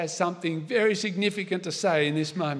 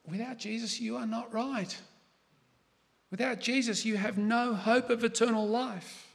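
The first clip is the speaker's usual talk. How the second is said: hushed mock scream, pitched high and building in volume; internal scream; 4 semitones higher than usual. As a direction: scream it under your breath, higher than usual. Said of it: "I scream internally".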